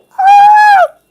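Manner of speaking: very high, loud scream; mock terrified scream. Comedian fearing God strikes her dead, mock terrified scream. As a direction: shriek as if about to be struck. Mock terror at own blasphemy.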